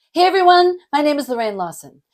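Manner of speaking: "Hey everyone" high and loud, then "Lorraine Lawson" rushed and dropping; bright high start on "Hey everyone", falling off through "Lorraine Lawson"; hurried name that sinks after a big "Hey everyone"; start high, end low. Shown as a demonstration. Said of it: "we start kinda high and we end low".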